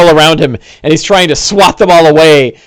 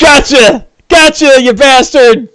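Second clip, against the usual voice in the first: gloating shout; 10 semitones higher, slower than usual. Acted. NPC gloats after hitting the player, gloating shout. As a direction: crow over the hit like a cackling villain. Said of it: "He goes".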